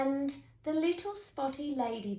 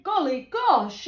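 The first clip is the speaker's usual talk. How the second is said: surprised farmer; 4 semitones higher, 9 dB louder than usual. A narrator's reading. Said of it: "the farmer woke and said".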